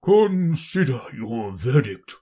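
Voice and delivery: slow king giving an order, unsettled; a rattled king addressing the jury slowly; low, trembling voice. Acted. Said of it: "in a low, trembling voice".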